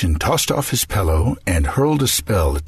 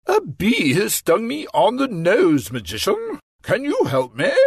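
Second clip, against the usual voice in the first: higher put-on king's plea; pained king; 14 semitones higher than usual. Stung king asking the magician for help.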